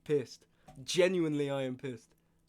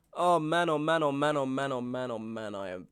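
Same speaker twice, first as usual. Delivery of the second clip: exasperated lament; 2 semitones lower than usual. Real, not acted. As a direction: moan it over and over, sinking. Venting over the muted stream.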